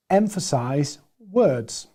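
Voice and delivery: "emphasize" and "words" slow, set apart; slow down and separate "emphasize" from "words"; emphasising. Shown as a demonstration. Presenter showing how to emphasise a point, emphasising. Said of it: "when I'm emphasizing a point".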